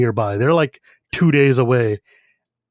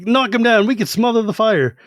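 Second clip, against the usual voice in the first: urgent call; 6 semitones higher than usual. Retold or imitated. People shouting to put fire out.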